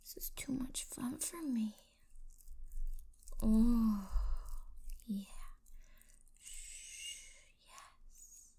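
ordinary talk

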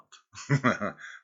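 mocking laugh. Mocking the stunned partner.

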